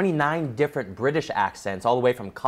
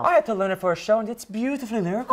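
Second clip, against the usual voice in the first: put-on lilting Irish accent; 5 semitones higher, faster than usual. Host reading Kayla's comment, Irish accent. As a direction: read it with an Irish lilt. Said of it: "I love the Irish accent".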